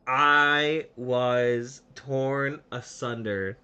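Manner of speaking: drawn out word by word, hammed-up devastation; dramatic slow delivery. Teller recalls being insulted by a kid, slow mock devastation. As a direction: stretch each word for comic drama.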